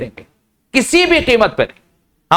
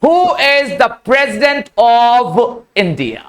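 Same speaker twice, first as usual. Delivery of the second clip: "Who" with the w sound, each word drawn out; very slow demo; much slower than usual. Shown as a demonstration. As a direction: read it out word by word, very slowly. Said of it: "वा है, वा।". (बहुत धीमे, हर शब्द अलग)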